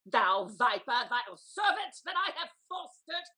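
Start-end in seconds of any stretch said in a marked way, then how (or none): none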